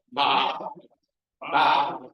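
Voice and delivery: loud bleating baa; sheep bleat. Acted.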